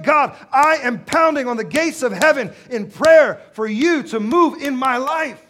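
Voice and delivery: high, full-force shouted plea; relentless, impassioned preacher's cry; desperate prayer. Acted. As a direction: pound out each phrase at God. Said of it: "We become desperate".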